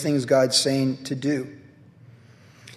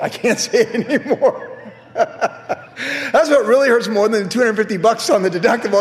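louder, higher words through laughter; laughing; 7 semitones higher, 5 dB louder than usual. Real, not acted. Pastor laughing about lost bragging rights. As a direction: laugh while admitting it.

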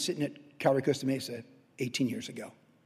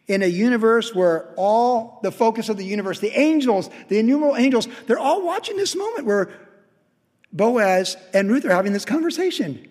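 high, loud pulpit preaching that keeps climbing; fervent preaching; 6 semitones higher, 5 dB louder than usual. Acted. Pastor exhorting his congregation to give, in full fervent preaching. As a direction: pound the message home from the pulpit.

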